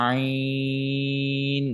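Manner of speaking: আইন very slow, four alif long; very slow আইন held long; long ain. Shown as a demonstration. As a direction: stretch আইন from the throat. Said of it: "কণ্ঠনালী থেকে মূলত আওয়াজটা হয়ে থাকে".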